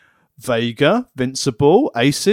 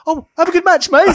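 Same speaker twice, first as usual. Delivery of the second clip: high, loud send-off; cheery shout; 6 dB louder than usual. Retold or imitated. Wrestler wishing his partner luck, high cheery shout. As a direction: yell it cheerfully. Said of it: "and Jake went".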